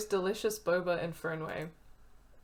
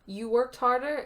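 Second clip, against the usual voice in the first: louder, higher, incredulous; indignant retort; 5 semitones higher, 7 dB louder than usual. Acted. Coworker snapping back during the blame argument, loud and indignant. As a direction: throw it back at her. Acted quarrel between characters.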